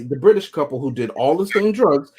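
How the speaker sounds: usual speaking voice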